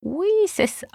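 high-pitched, bashful little yes; shy smile. A narrator's reading. Rosa admits she wants a dating profile, shyly. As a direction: answer shyly through a smile, voice lifting high. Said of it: "Elle sourit timidement".

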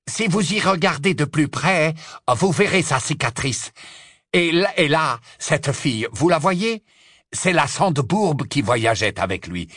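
loud, high eager pitch; eager excited. Acted. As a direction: eagerly press the proof on her. Scabior eagerly presents the prisoners.

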